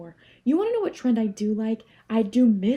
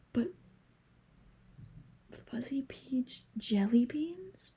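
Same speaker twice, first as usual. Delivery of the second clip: hushed whisper; 2 semitones higher, 8 dB quieter, slower than usual. Pretend. Longing for discontinued candy.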